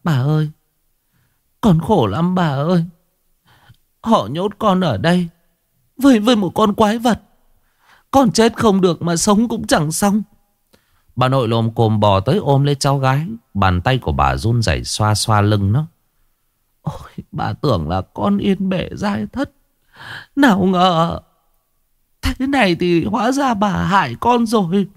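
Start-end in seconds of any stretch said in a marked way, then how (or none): 0.0-10.2 high tearful wail; sobbing girl. A narrator's reading. Xuân crying to her grandmother in the cellar, high tearful voice. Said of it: "bò đến ôm chầm lấy bà nức nở". 16.8-24.9 high, tearful lament; grieving grandmother. A narrator's reading. Grandmother lamenting to Xuân, high weeping voice.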